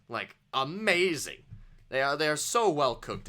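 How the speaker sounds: ordinary talk, nothing marked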